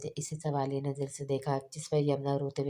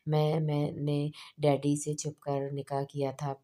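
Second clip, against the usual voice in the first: plain reading voice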